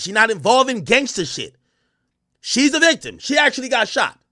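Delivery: high, loud, emphatic protest; indignant rebuttal. Retold or imitated. Team defending Meg against the rumors, indignant. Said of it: "put out the statement to say".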